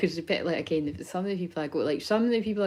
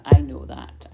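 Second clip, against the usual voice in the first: emphatic; 3 semitones lower, 13 dB louder, slower than usual. Real, not acted. Acknowledging viewers' appreciation.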